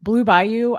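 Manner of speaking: normal talking voice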